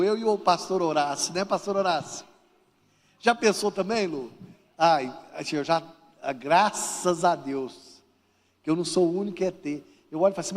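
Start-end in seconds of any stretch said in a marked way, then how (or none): none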